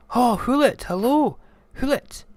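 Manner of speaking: high, loud halloo; high mouse voice, calling out; calling out greeting. Acted. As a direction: hail the owl up in the trees. Mouse hails the owl.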